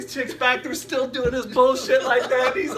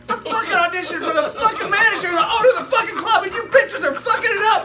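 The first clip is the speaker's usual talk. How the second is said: fast, high, loud rant, laughing while telling it; a lightly voiced furious comic tearing into the crowd; retold rant at crowd; 11 semitones higher, 8 dB louder, faster than usual.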